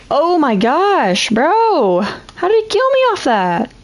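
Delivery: high, drawn-out disbelief; outraged cry at an unfair death; raging gamer. Real, not acted. Killed in game, raging.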